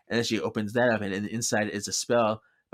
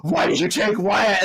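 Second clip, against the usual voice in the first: louder, higher angry demand; mad; 8 semitones higher, 6 dB louder than usual. Retold or imitated. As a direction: confront her, raised and higher. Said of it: "he's, like, so mad".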